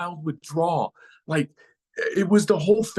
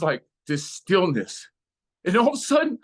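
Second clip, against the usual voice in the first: usual speaking voice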